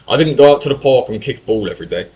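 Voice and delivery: normal talking voice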